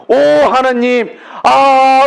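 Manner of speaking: a preacher acting out a moved prayer of thanks; fervent thanks. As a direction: cry out "오, 하나님" in grateful awe. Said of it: "이렇게 여러분이".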